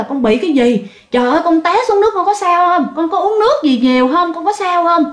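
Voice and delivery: high, urgent string of worried questions; worried questioning. Retold or imitated. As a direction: imitate anxious family checking on a child. Retold grandparents questioning rescued child. (giả giọng người nhà hỏi dồn, lo lắng)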